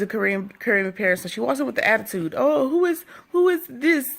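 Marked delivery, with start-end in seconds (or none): 2.4-4.1 high-pitched imitation Creole accent. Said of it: "she has a Creole accent".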